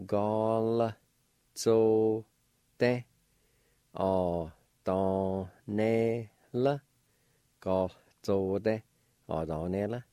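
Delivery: each syllable of Gall zo de o do ne le said apart and clearly. Shown as a demonstration. Teacher sounding out a word for students, syllable by syllable. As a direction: sound out Zo, de, O, do, ne, le one by one. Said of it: "It'll sound like this".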